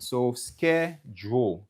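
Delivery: "schedule" slowed into two clear syllables, skeh-jool; "schedule" drawn out slowly, stress on the first syllable; syllables spelled out. Shown as a demonstration. Teacher models the word for the class, slow and careful. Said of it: "C'est deux syllabes".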